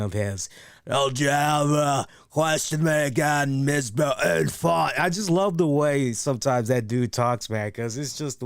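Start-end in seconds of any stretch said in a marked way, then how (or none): 0.9-4.9 self-admittedly bad British accent; a rough Latimer English accent; worst Tom Latimer impression. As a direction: reviewer redoing the Latimer line in a British accent. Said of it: "worst Tom Latimer impression".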